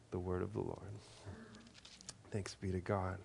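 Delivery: soft, low closing formula; liturgical response. A narrator's reading. Lector closes the reading for the congregation, soft and low. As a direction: let the reading end hushed.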